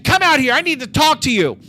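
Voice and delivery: high, loud retold maternal order; angry mother. Mom summoning her son from his bedroom, angry. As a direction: order the kid out, imitating a furious mom.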